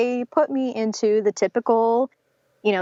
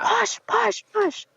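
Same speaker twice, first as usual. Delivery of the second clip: much higher, shrill-pitched mock yell; mock yelling midwife; 12 semitones higher, slower than usual. Midwife yelling at mother to push, high mock yell. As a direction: bark the order like she did. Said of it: "she's yelling at me".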